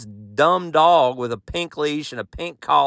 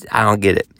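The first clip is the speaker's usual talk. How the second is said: ordinary talk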